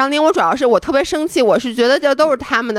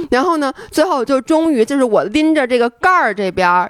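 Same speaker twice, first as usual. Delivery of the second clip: usual speaking voice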